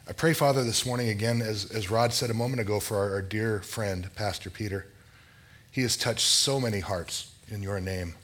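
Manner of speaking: earnest, measured prayer voice; opening prayer. Acted. Pastor praying aloud for the congregation, reverent and measured.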